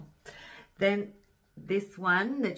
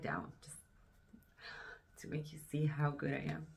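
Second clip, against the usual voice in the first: ironic whisper; 10 dB quieter, faster than usual. Real, not acted. Fumbling the camera, joking.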